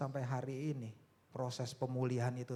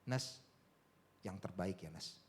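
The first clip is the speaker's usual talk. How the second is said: casual gentle reminder; 4 semitones lower, slower than usual. Retold or imitated. Father sending child to an exam, casual gentle reminder.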